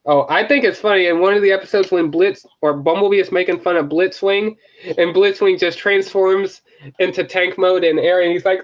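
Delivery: ordinary talk, nothing marked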